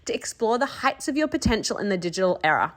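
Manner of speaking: bright, polished announcer read of the show opener; podcast intro read. Acted. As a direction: sell the welcome like a radio host.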